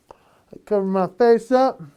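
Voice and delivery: normal talking voice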